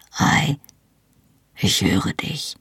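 barely breathed, slowly; quiet. Acted. Jamie answers Claire in a faint hushed whisper. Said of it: "sagte er leise".

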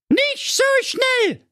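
very high, slow shouted protest; shrill shout. Acted. Jacqueline refusing to marry Mirko, very high slow shout. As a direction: stop him cold with a shrill cry. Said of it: "rief da die Jacqueline".

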